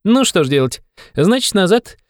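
high, youthful cry of disappointment; young man exclaiming. A narrator's reading. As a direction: exclaim regretfully, voice pitched well up. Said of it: "вскричал молодой человек". (высокий возглас молодого человека)

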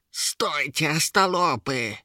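high, angry creature command; Yera barking. A narrator's reading. Troll-woman tries to stop the imps, high angry bark. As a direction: roar a halt at the fools. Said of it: "рявкнула Ера".

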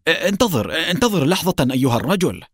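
high, hurried plea in a panic; panicked cry. A narrator's reading. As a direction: beg him frantically to hold on. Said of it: "صاح ويليام بفزع جنوني".

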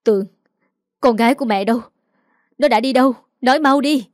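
a worried mother's rising plea; trembling voice. A narrator's reading. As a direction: start shaken, build to an urgent shout. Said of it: "nói giọng run rẩy".

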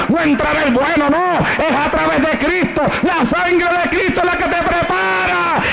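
high, impassioned preacher's voice; building sermon fervor; fervent preaching. Acted. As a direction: lift the crowd toward Christ. Preaching climax.

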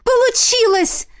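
high, drawn-out cry of joy; joyful exclamation. A narrator's reading. Heroine seeing the dead man revived, joyful exclamation. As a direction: squeal it with delight. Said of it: "воскликнула я".

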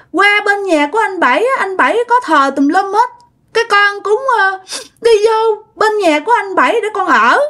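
put-on high, sulky little-child voice; toddler voice. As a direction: whine about the grown-ups like a small kid. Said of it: "giống như đứa con nít mà hai ba tuổi".

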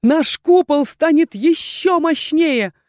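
high, loud joyful announcement. A narrator's reading. Erhan tells the team joyfully. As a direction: sing out the good news. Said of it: "радостно заявил Эрхан".

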